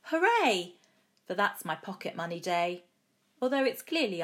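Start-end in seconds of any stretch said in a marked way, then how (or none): 0.0-0.7 high-pitched, lightly shouted cheer; a child's mild hooray shout; joyful shout. A narrator's reading. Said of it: "I shout".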